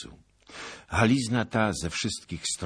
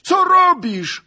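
very high, loud shriek of protest; alarmed scream; 14 dB louder, slower than usual. A narrator's reading. Nobleman as knight reins in his horse, panicked yell. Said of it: "krzyknął szlachcic".

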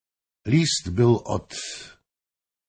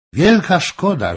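higher, louder mocking laugh in the words; laughing king; 9 semitones higher, 7 dB louder than usual. A narrator's reading. King teases Firstenberg over wine, laughing. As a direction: tease him through laughter. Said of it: "król się śmiać zaczął".

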